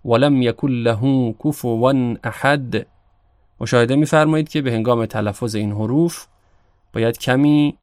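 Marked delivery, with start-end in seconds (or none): 0.0-2.9 احد ending in a slow bouncing د; qalqala on dal. Shown as a demonstration. Teacher reciting the verse to show the final bouncing dal. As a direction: let the final د of احد echo. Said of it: "دو مرتبه حرف دال".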